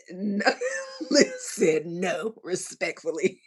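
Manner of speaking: loud, breaking up with laughter; laughing protest. Real, not acted. Host reacting to a viewer's comment, laughing hard. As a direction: protest loudly through helpless laughter.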